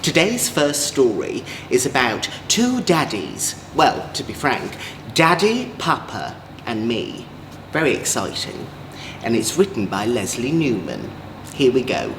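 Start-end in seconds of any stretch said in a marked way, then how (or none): none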